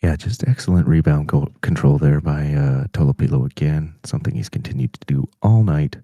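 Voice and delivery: low, loud hushed-style golf commentator; golf announcer voice. Acted. Colour commentator doing a golf voice for the chat, low and loud. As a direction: call hockey like a golf broadcaster. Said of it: "The best time for the golf voice is".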